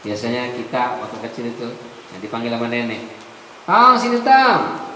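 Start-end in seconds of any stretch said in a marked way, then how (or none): 3.7-4.9 loud, high call across the house; mock calling out loud; grandma calling out.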